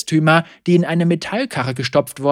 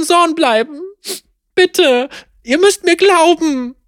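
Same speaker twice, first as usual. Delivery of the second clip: tearful pleading; 10 semitones higher than usual. Acted. Apprentice begs the sheriff to believe her, tearful. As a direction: beg for your life through tears. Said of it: "ihr immer theatralischer werdendes Flehen".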